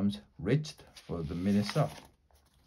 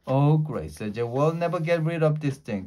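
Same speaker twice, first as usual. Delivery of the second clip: exasperated; 6 semitones higher, 5 dB louder than usual. Acted. Jack exasperated.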